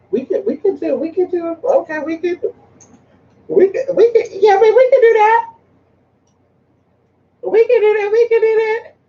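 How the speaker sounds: climbing into a high squeal; high-pitched, stammering mock voice; acted quote. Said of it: "He said".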